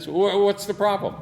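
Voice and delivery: high and loud, acting baffled; mock indignant surprise. Retold protest to the clerks.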